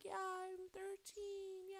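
very high, slow and soft; breathy mock thrill. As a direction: squeak it like a giddy kid. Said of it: "No, I'm like".

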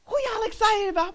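high-pitched eager cheering; excited onlooker. Retold or imitated. Somebody joining excited believers, high eager cheer. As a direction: burst in cheering, eager to join. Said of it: "if we're excited like that".

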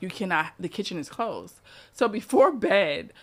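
ordinary delivery

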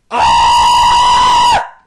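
high loud anguished scream. Real, not acted. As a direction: scream in frustration. Near miss reaction.

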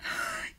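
a high, breathless gasp of shock; sharp excited gasp. Real, not acted. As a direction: catch your breath in disbelief. Reacts to hearing his reply.

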